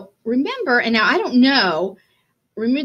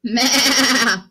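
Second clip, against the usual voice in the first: goat bleat; 4 semitones higher, 5 dB louder than usual. Acted. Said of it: "said Goat".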